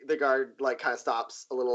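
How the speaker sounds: usual speaking voice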